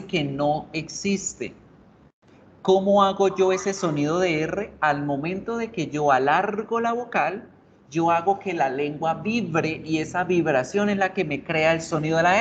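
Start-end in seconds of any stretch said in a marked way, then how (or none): none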